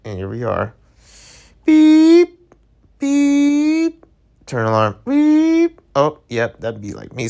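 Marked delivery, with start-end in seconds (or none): 1.7-4.1 high, steady mock alarm beep; alarm beep imitation. Mimicking the phone alarm going off, a high steady beep. 5.1-5.8 high, steady mock alarm beep; alarm beep imitation. Mimicking the phone alarm going off, a high steady beep.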